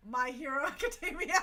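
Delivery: loud title breaking into high laughter; laughing shout. Real, not acted. Host laughing over the show title, loud and giddy. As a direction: crack up on the name.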